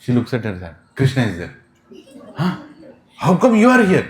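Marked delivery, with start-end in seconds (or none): 2.4-4.1 high, loud disbelief; raised-voice astonishment; startled. Acted. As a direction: exclaim in sudden comic shock. Gopi startled to find Krishna.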